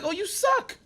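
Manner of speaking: mock dismissive. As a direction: sneer it in a high voice. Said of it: "I'm like".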